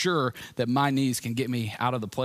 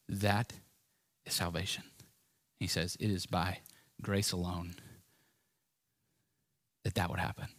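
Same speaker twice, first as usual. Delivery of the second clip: hushed meditation; 5 semitones lower, 8 dB quieter than usual. Acted. Pastor guiding the congregation to picture the cross, hushed and much softer. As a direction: paint the scene in a near whisper.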